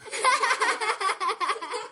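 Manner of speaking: high giggling burst; kids laughing. A narrator's reading. Leslie and Nate laughing at Buster's joke, giggling. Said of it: "burst out laughing".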